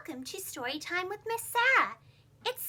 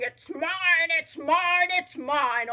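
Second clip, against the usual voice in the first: louder imitation of the frogs' shouting, slowing at the end; mock shouting; 7 dB louder, much slower than usual. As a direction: toad mimicking the frogs' endless claims. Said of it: "I can hear you shouting".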